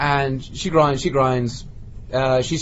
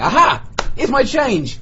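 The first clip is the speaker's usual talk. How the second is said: high, louder eureka cry; mock triumph; 9 semitones higher, 4 dB louder, slower than usual. Spotting her with his money, mock triumph. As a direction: pounce on it in a high voice.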